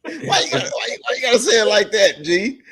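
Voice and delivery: high, loud protest through laughter; amused, high-pitched objection; laughing protest. Real, not acted. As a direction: tease them while cracking up. Teasing co-host's delivery, laughing.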